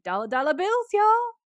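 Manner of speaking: hammed-up hype-man boast, rising sharply; cringy bravado. Trevor boasting about summoning demons for pay, cringy bravado. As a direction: climb to a high, loud whoop. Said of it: "It's so cringy".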